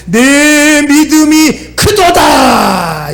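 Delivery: preacher voicing a grand pronouncement; resounding proclamation. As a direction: proclaim it slowly, stretching every phrase. Jesus proclaims the woman's faith.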